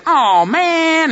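high whiny "Oh, man"; sulky cartoon fox giving up; disappointed cartoon fox. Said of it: "he leaves and says".

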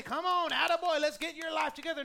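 over-hearty, booming encouragement; mock pep talk. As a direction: cheer them on like a hearty coach. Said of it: "He doesn't come in and say".

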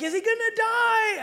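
put-on high, loud, frightened little girl asking; panicked child. Daughters scared, brother bleeding.

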